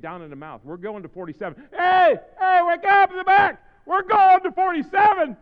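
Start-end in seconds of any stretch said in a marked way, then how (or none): 1.7-3.6 high, very loud shout to the back; calling out. Real, not acted. Calls out to back rows. 3.9-5.4 high, very loud preacher's shout; shouted announcement. Real, not acted. Repeats it shouted to back rows.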